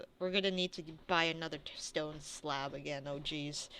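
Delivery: soft grumble under the breath; mumbling. Acted. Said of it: "You see him mumble".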